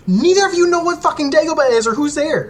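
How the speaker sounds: a hammed-up high voice telling the others off; mock rant at teammates. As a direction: squeal it out like an exasperated know-it-all. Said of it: "I'm like".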